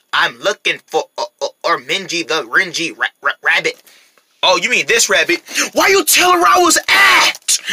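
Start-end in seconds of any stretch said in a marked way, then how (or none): 0.1-3.8 halting mechanical stutter; robot pig. Acted. Pig asking dolphin for the rabbit, robotic. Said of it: "acting like a robot". 5.7-7.6 high, drawn-out yell; panicked protest. Acted. Rabbit betrayed by dolphin, panicked protest.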